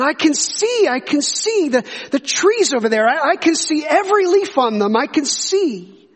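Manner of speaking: high-pitched, amazed retold outburst; exclaiming. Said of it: "and he exclaimed".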